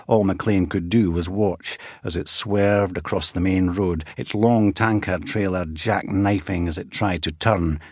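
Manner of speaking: ordinary talk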